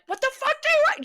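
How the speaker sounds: high, loud mock challenge; retold confrontational yell. Acts out facing the boss at the door, high confrontational shout. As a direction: yell it, re-enacting readiness to pounce. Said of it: "So I came out like".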